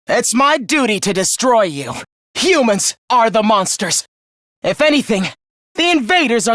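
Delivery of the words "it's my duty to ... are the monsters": usual speaking voice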